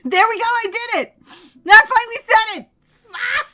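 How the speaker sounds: real loud, high burst of triumph; triumphant shouting. Real, not acted. Streamer finally gets the hard word out, triumphant shout.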